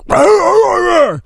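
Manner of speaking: imitated snarling dog; dog growl. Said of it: "growl, like".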